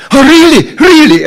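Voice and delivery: high, loud mock astonishment; exaggerated wide-eyed disbelief; amazed reaction. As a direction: squeal it as an astonished bystander. People reacting to the excited devotees.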